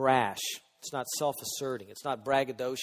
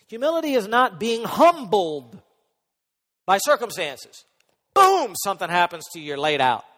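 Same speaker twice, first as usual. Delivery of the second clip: loud, raised pulpit voice; forceful preaching; 5 semitones higher, 12 dB louder than usual. Real, not acted. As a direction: proclaim it hard to the congregation.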